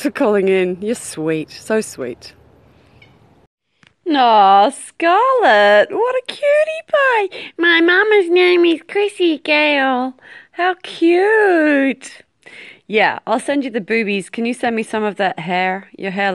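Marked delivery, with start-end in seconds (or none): 4.1-12.2 very high, loud drawn-out squeal; gushing coo. Real, not acted. Gushing over a cute child.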